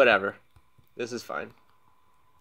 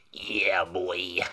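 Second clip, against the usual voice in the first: put-on low, drawn-out "yeah boy"; playful low hype; drawn-out catchphrase; 5 semitones lower than usual. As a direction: stretch it out low, goofy swagger.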